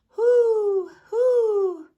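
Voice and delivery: high, loud hoo hoo; owl hooting. A narrator's reading. Owl hooting at Frank in the woods, high and loud. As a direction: hoot like an owl.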